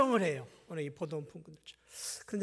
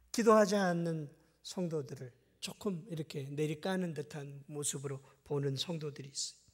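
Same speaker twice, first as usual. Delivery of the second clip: normal talking voice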